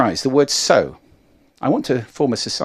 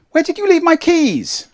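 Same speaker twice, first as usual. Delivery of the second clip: very high, louder frantic question; high-pitched, excited; 5 dB louder than usual. Shown as a demonstration. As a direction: ask it way up high in alarm. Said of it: "change your excitement just with pitch".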